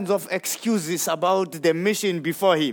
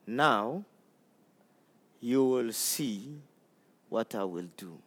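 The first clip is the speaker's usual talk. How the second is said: hushed promise; 10 semitones lower, 11 dB quieter than usual. Acted. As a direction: drop almost to a hush.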